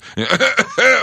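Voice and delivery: loud, high put-on coughing groan; noisy fake hacking to cover up gas; exaggerated old man's grunty cough; deliberate old-man grunting. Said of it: "кряхтя специально, чтобы заглушить отходящие газы".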